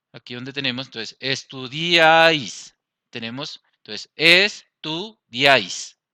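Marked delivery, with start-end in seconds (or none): 1.2-2.8 "estudiáis" said slowly, syllable by syllable; slow teacher demo of "estudiáis". Shown as a demonstration. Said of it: "De tres sílabas". 4.2-6.0 "estudiáis" said slowly, syllable by syllable; slow teacher demo of "estudiáis". Shown as a demonstration. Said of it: "De tres sílabas".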